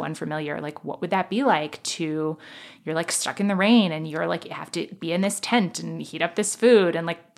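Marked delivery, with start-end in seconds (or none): none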